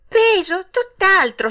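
high, loud exclamation; bright-eyed, high-pitched rebuttal; surprised denial. A narrator's reading. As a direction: protest it eagerly like a child. Puppet denies being heavy.

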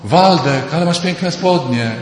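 high, loud gushing praise of trousers; teasing mock admiration. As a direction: ham up a man cooing like a woman. Mocking man gushing like women.

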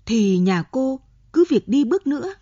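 shouted retort to a stubborn daughter; snapping loudly. A narrator's reading. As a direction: bark it back at the daughter. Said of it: "Bà gắt toáng lên".

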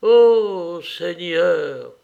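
very slow, high, loud opening falling away; deep dying sigh. A narrator's reading. Dying man calling on the Lord, long sighing exclamation. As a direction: sigh the words out at length. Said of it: "Et il soupira profondément".

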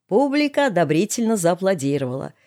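plain reading voice

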